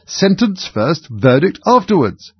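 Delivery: high, slow, emphatic decree; drawn-out, swooping royal order; imperious command. A narrator's reading. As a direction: proclaim it like a tyrant queen. Queen loudly overrules the King.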